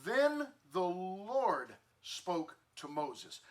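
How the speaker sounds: each word laid out slowly; deliberate scripture emphasis; slow emphatic reading. Shown as a demonstration. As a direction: read it slowly so it sinks in. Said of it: "I want you to understand".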